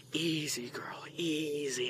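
whispered, slow and gentle; calm the mare under your breath; soothing whisper. Retold or imitated. Kid whispering to a nervous horse, gentle soothing whisper. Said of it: "he goes".

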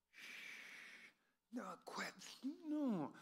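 urgent whisper shushing her husband; hushing whisper. Retold or imitated. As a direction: hiss at him to keep quiet, no voice. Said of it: "Eve had to have been looking at, at Adam going".

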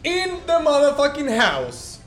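high, loud hype shouting with laughter; hype announcer shout. Acted. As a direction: announce it like a ring MC. Hyping up episode fifty.

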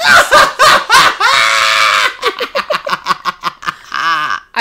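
loud shouted laughing fit. Real, not acted. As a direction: bursts out laughing at the story. Laughing at own tweet story.

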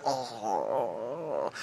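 low, very soft falling "oh"; long, sinking groan; weary groan. Retold or imitated. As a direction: groan under your breath in exasperation. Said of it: "and I just go".

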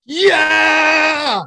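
big high cheering yell; a high, loud whoop of excitement; excited shout. Real, not acted. Cheering the cameo.

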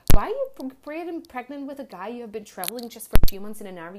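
hammed-up disapproving voice; judgmental critics. Imitating people who judged her pregnancy, mock indignant. Mocking quote of judging people.

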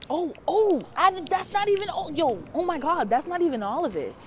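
high, excited burst of remembering; sudden realization. Real, not acted. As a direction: blurt it out as the memory hits.

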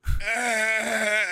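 high, drawn-out mock moan; imitated ghostly groan. Sick lady is wheeled off, imitated ghostly groan. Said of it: "kinda like a ghost".

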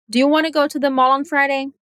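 "do you" squeezed very fast, "wanna", "go", "mall" and "Friday" stressed; reduced do you. Shown as a demonstration. Teacher showing the natural rhythm with a quick do you. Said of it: "Mais uma vez".

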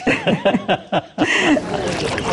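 a long, high, happy laugh; delighted laugh. Real, not acted. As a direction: laugh at your own joke. Laughs at his own joke.

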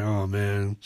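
ordinary talk

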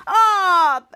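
loud mock cry of disgust; hammed-up grossed-out shout; yell of disgust. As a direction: yelp as if sneezed on. Man just got sneezed on.

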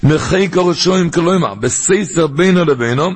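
high, measured study-hall singsong; singsong text reading. Shown as a demonstration. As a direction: intone the holy text phrase by phrase. Reads the commentary aloud in learning tune.

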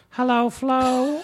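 very high, slow squeak; high squeaky voice. Retold or imitated. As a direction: stretch it out in a tiny high voice. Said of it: "I would go".

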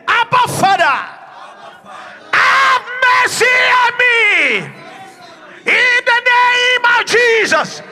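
high, loud crying out in prayer. Shown as a demonstration. Pastor showing the church how to cry out loud. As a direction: cry out to the Father at full voice. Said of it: "You are going to cry loud and clear".

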